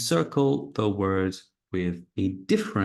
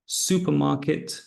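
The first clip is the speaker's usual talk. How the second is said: SUpermarket, first syllable higher; stress on first syllable; 5 semitones higher than usual. Shown as a demonstration. Teacher showing word stress. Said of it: "that's one".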